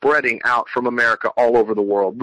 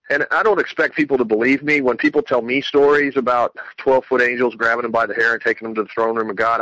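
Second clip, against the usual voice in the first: ordinary delivery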